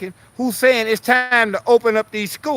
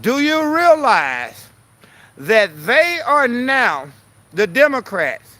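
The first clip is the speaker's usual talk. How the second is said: slow, deliberate and spelled out; mock-patient slow delivery; said real slow; slower than usual. Shown as a demonstration. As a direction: drag each word out for the slow ones. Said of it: "I'ma say it real slow".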